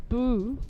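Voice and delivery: Boo drawn out as a long rounded oo; Swedish vowel. Shown as a demonstration. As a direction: say Boo, not Ba. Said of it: "Not Ba".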